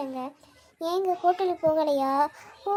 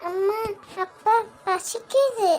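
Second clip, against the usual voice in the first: put-on slow, childish whine; whiny hungry child; 3 semitones higher, slower than usual. Son telling his parents he is hungry, slow childish whine. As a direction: drag it out like a hungry little boy.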